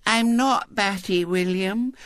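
put-on high, slow little-girl meekness; disarmingly meek. A narrator's reading. Girl answering William's insult, disarmingly meek. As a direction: correct him meekly as a small child. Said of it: "in a tone of disarming meekness".

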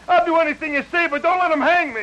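desperate high begging; pleading. Acted. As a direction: plead for your life. Begging not to be hanged.

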